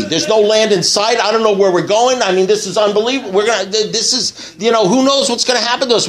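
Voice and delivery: high, frantic complaining castaway; panicked rant. Retold or imitated. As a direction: pour it out in rising alarm. Stranded man panics third time.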